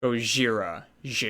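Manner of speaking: Gojira with a zh in the middle; soft zh. Shown as a demonstration. Co-host sounds out Godzilla's Japanese name, Gojira with zh. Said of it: "do a zh".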